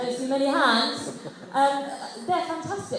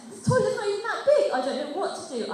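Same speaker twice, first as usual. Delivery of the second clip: panicky; 7 semitones higher, faster than usual. Retold or imitated. Panicking in toilet.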